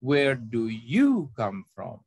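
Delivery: drawn-out question leaning on YOU; stress on YOU. Shown as a demonstration. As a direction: slowly punch YOU and drop the end. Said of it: "Di mana tekanannya".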